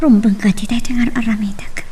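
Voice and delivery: unvoiced worried whisper. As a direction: breathe it out under your breath. Secret whispered aside after call.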